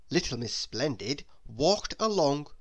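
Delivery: ordinary delivery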